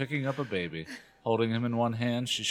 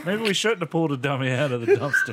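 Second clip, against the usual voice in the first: louder, laughing through words; 4 semitones higher, 6 dB louder than usual. Real, not acted. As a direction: read it while cracking up.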